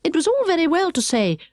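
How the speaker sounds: ordinary delivery, nothing marked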